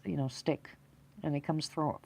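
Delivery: ordinary delivery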